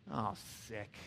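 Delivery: a low, quiet grossed-out groan; mock the onlookers' disgust; disgusted onlookers. Onlookers mocking young lovers, quiet disgusted groan. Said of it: "people look at you from afar and go".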